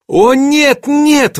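high, loud cry of denial; exclaiming. A narrator's reading. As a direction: cry out in agitated refusal. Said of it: "воскликнула она". (взволнованно восклицая)

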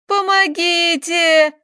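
high, loud, long-drawn cry; screaming for help. A narrator's reading. Attacked girl to the village, screamed plea. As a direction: call out high to the whole village. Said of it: "на всю деревню закричала Таисия".

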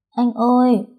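put-on high, anxious little-girl call; frightened call. A narrator's reading. Trembling girl to her bleeding friend, frightened call. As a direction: call to him in fear. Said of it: "Cô run rẩy".

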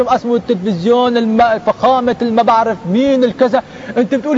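ordinary delivery

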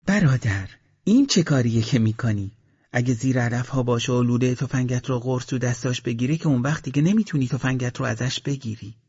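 a wife scornfully daring the soldier to look; defiant wife. Acted. Shahru mocks the gunman.

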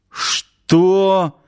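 high, incredulous what; acted astonishment; stunned question. A narrator's reading. Commander stunned by the claim.

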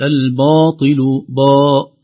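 الباطل said with a heavy ba; the mistaken الباطل, its ba made emphatic; wrong heavy ba. Shown as a demonstration. Teacher to students, showing a wrongly heavy ba. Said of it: "ليست".

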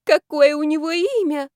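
put-on drunkenly smitten, stretched out high; dreamy tipsy gushing. A narrator's reading. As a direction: swoon over the name. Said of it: "продолжала я опускать розовые пузыри".

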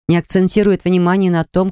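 plain reading voice

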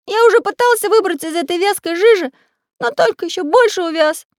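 high, loud cartoon explaining; squeaky pencil character. A narrator's reading. Pencil explaining his plan to friends, squeaky. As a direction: chatter it in a little squeaky voice.

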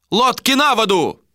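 high, carrying order; shouted command. A narrator's reading. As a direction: call the order over the deck. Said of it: "раздалась команда Йола".

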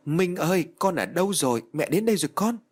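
high-pitched, lightly acted wailing mother; tearful lament. A narrator's reading. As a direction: call out to a lost child in grief. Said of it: "Chị khóc lóc quỳ xuống rồi bảo".

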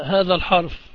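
normal talking voice

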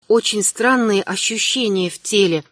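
ordinary delivery

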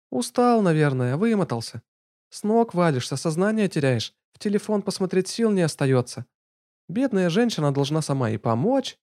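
high mock-pitying woman; sarcastic young woman. Lana's sarcastic voice.

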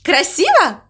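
high, pleased question; happy question. A narrator's reading. Mama Mu asks crow for praise, high and happy. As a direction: ask high, hoping for approval. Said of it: "Она была счастлива".

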